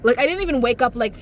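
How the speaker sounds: usual speaking voice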